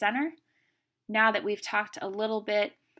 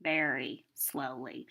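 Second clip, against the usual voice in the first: slow, stretched-out, lower words; 5 semitones lower, 3 dB quieter, slower than usual. Shown as a demonstration. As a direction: drag each word out slowly. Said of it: "he tells stories very, very slowly".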